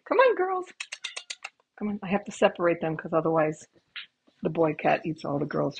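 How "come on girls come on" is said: very high sing-song call to the cats. Real, not acted. Owner calling her girl cats over, high and coaxing.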